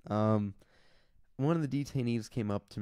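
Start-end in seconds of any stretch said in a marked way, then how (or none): none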